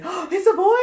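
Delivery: high, loud mock surprise; imitated excited gasp. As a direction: squeal it like someone thrilled at news. Mimics everyone's reaction to "he.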